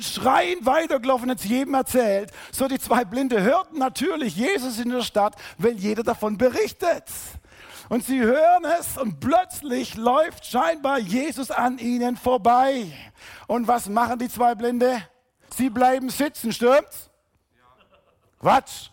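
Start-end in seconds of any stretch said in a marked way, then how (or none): none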